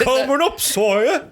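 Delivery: high laughing barmaid voice; high old-timey voice. Acted. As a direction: answer in a squeaky serving voice. Old-timey tavern bit.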